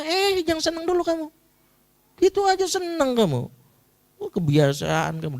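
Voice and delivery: mocking father. Father belittling his child's success, mocking. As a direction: cut the happy child down scornfully. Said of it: "caranya itu cara ngenyek gitu".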